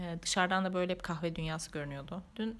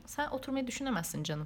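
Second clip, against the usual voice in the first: sarcastic; 7 semitones higher than usual. Retold or imitated. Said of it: "falan yapıyorum içimden".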